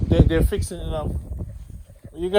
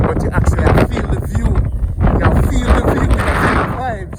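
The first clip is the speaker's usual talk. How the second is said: excited; 3 semitones higher, 7 dB louder than usual. Real, not acted. Marvelling at the view.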